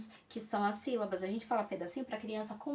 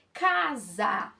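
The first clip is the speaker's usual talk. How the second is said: "casa" drawn out syllable by syllable; slow, deliberate "ca-sa" demo; sound it out; 4 dB louder than usual. Shown as a demonstration. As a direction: stretch both syllables of "casa" so the sound stands out. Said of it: "olha o som".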